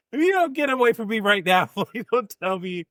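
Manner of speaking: high, laughing mock protest; mock indignant. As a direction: shoo them off in mock outrage. Retold reaction to "brave.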